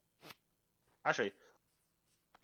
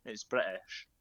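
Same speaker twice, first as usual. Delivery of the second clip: British said with a swallowed t; lower, British-accented British; 4 semitones lower, 4 dB quieter, slower than usual. Shown as a demonstration. Insisting to friends it is British, British accent. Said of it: "[name] says British".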